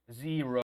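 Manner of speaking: Zero with a drawn-out buzzing start; Zero, its Z vibrating. Shown as a demonstration. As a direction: demonstrate the voiced Z in Zero. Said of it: "Tiene que existir esa vibración".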